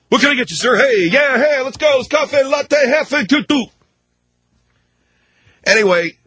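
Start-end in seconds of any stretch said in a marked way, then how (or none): 0.1-3.6 mock barista. Mocking a hyped-up barista.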